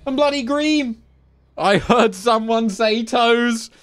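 thrilled high call-out; excited shout. Real, not acted. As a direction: greet him like a hero arriving.

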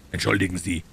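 ordinary delivery, nothing marked

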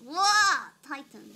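normal talking voice

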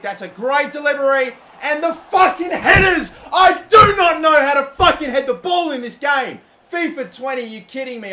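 loud, high angry yelling with a laugh; frustrated shout. Real, not acted. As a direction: rage at the missed header, shouting. Missed headers in football game.

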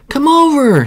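high, loud call across water; high, loud call to come over. Acted. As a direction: call cheerfully over the river. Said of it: "she called".

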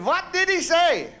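high, slow mock Pakistani accent, eager question. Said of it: "So there was this Pakistani".

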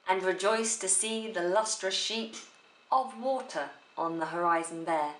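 "sheet" held in the air, brief gap before "of water"; suspensory pause. Shown as a demonstration. Teacher repeating the line to show enjambment, suspensory pause. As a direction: hold after "sheet" as if before a fall. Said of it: "there's just a little hold moment".

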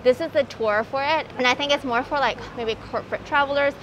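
muffled behind surgical mask; through a face mask. Real, not acted. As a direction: mouth covered by mask.

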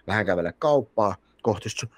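normal talking voice

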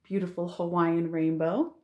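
ordinary delivery

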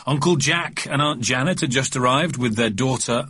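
ordinary delivery

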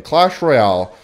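ordinary delivery

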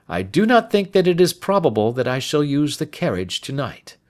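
composed sleuth settling in; unhurried Holmes. Acted. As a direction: reason it through at ease. Voices Holmes in dialogue.

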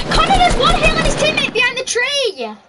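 high, loud warning to teammate; urgent callout. Real, not acted. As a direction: shout it across to Connor.

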